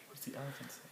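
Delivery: soft question. Shown as a demonstration. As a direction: ask it gently and softly.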